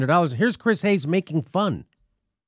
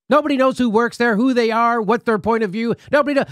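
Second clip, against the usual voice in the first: higher, exasperated disbelief; incredulous rant; 6 semitones higher than usual. Real, not acted. Ranting about the unknown company.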